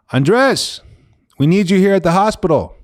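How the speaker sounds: high, loud, urgent call; nurse calling out. Retold or imitated. ICU nurse summoning Andres to the hospital, high urgent call. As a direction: imitate a nurse summoning someone.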